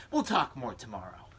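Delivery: ordinary talk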